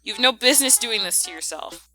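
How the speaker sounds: ordinary talk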